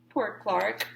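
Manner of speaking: plain reading voice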